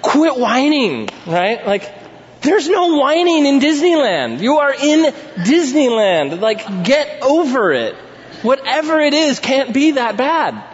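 dad telling his daughter to quit whining in Disneyland; exasperated dad. Retold or imitated. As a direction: scold a whining child, half laughing at the absurdity. Dad scolding whining four-year-old.

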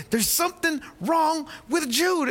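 a father pressing his wife with worry; worried. Retold or imitated. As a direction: urge it slowly, a father's alarm. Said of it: "And I told my wife".

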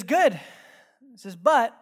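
GOOD and BUT punched loud; emphatic pronouncement. Retold or imitated. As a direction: hit "Good" and "But" hard, then stop. Voices Jesus's emphatic turn.

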